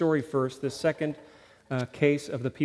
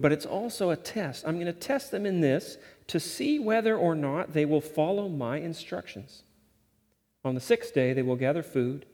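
scripture reading; 3 semitones higher than usual. Acted. Reading scripture aloud with force.

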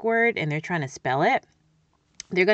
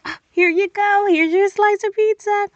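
much higher and louder, sing-song; cheerful mom voice; 14 semitones higher, 8 dB louder than usual. Retold or imitated. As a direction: hand over the pizza brightly. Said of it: "And I'm like".

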